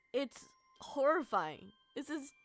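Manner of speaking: slow, then loud indignant outburst; outraged. Real, not acted. Reacting to experiments on children, outraged loud outburst. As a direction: let the disgust boil over.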